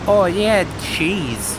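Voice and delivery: put-on Minnesota accent. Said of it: "Minnesota accent".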